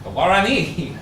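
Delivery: The Guarani stressed on the last syllable, never pluralized; correcting pronunciation. Shown as a demonstration. Professor insists, saying The Guarani as one tribe. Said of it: "You wouldn't pluralize the name".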